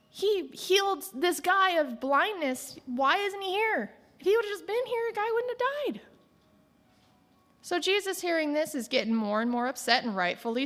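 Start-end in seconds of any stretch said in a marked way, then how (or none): 0.1-6.0 high, whiny mimic of the doubting crowd; higher, complaining murmur; mocking doubters. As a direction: sneer it like a gossiping bystander. Doubters murmuring behind his back.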